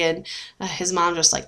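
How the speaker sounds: ordinary talk, nothing marked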